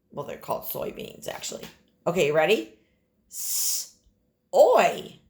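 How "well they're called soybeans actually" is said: whispered aside. Real, not acted. Aside correcting herself.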